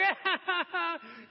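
high, hammed-up bawling in bursts; mock wail. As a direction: bawl theatrically.

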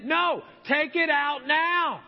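a mildly shouted, drawn-out order; mock firm order. Ordering the speaker to take the trash out, mock firm.

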